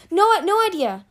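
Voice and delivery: high, loud flustered yelp. A narrator's reading. Captive denies knowing anything, yelping. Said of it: "Pirate yelped".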